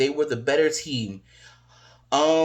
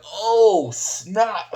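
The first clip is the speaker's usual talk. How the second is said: raised, loud oh snap; surprised; 8 semitones higher, 4 dB louder than usual. Retold or imitated. Fan reacting to a big knee drop, surprised. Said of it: "I'm like".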